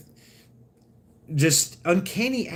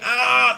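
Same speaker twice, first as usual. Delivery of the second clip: mock yelp; 8 semitones higher, 4 dB louder than usual. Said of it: "And she's like".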